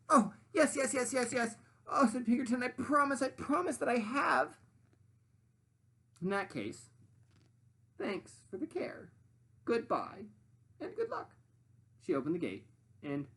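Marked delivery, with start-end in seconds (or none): 0.1-4.6 high, loud eager pig promising; high breathless eager yeses. Acted. 6.2-11.4 high bossy sandwich lady voice. Acted. Sandwich farewell.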